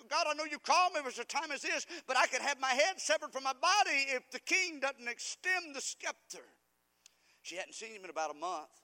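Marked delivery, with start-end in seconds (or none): 0.1-6.5 higher, louder, urgent plea; anxious pleading prayer. Acted. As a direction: Esther afraid for her life. Voicing Esther's fearful prayer.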